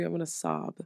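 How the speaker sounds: normal talking voice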